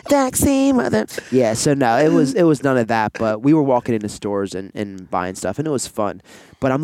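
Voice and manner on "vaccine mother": squeaky, loud teasing voice; high mock voice. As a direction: join the squeaky joke. Joking high voice.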